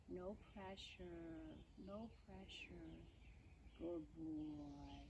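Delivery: soothing. Real, not acted. Rider calming her nervous horse by the mounting block, soothing. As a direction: settle a nervous animal gently and slowly.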